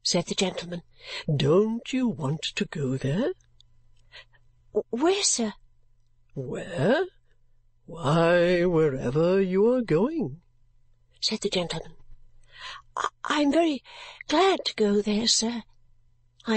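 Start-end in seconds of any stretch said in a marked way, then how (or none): 1.3-3.3 very slow, lower, gruff question; gruff gentleman. Acted. 4.7-5.5 higher, stammered frightened where; startled girl answering the stranger. Acted. 6.3-10.4 gruff gentleman. Acted. Gentleman echoes. 13.0-15.6 put-on higher, hesitant timid girl; shy girl answering the stranger, hesitant; nervous.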